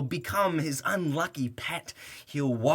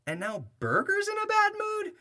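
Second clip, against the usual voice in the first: much higher, incredulous mock voice; mock outrage. Narrator mocking Burger, high squeaky outrage. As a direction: squeal it in disbelief.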